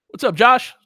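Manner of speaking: ordinary talk, nothing marked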